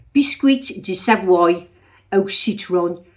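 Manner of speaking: loud, slow, mock Brummie French; Brummie-accented French. Imitating Brummies in French class, hammed-up Brummie accent. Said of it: "when you're sitting next to Brummies and you're saying".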